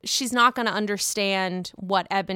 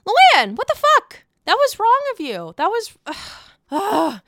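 outraged; 9 semitones higher, 7 dB louder than usual. Real, not acted. Vlogger reacting to Luann's dismissal, real outrage. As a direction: yell it at the screen in disbelief.

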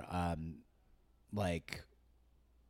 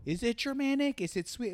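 put-on half-dip into an accent on "Is it Germanic"; sneaking a faint foreign accent into the question; slipping into an accent; 13 semitones higher than usual. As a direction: pitch jumps much higher on "Germanic", with a hint of a German-ish "chermanic". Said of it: "Did you kind of do it just then?".

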